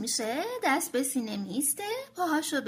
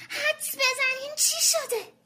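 excited shout; 11 semitones higher than usual. Acted. Tommy bursting into the kitchen, excited. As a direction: burst in with happy news. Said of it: "داد زد".

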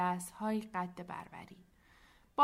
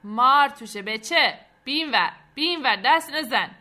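loud, raised bursts of alarm; shouted warning; 4 semitones higher, 14 dB louder than usual. A narrator's reading. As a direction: shout the kid away from the box. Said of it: "سرش را ... بیرون آورد و داد". (فریاد هشدار)

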